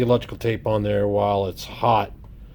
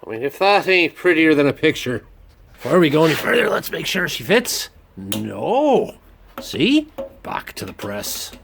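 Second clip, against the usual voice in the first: put-on a light, higher Irish accent on each line; 6 semitones higher than usual. Drilling steel in the garage, in a playful Irish accent. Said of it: "I don't know why I'm doing an Irish accent today".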